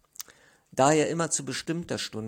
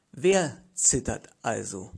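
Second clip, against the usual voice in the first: mocking question; 5 dB louder than usual. A narrator's reading. Mocking question to defeated neighbor.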